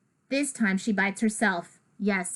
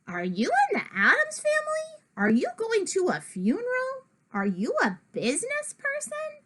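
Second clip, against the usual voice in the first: put-on curious kids; 12 semitones higher than usual. Classmates question the outfit.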